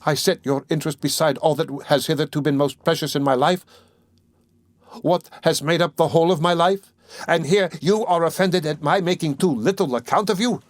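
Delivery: indignant outburst. A narrator's reading. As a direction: let her indignation climb. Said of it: "cried Dunya, flushing".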